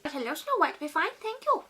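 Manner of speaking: high, chirpy chicken chorus. Retold or imitated. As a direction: cluck it out brightly, rising at the end. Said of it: "the chickens respond with".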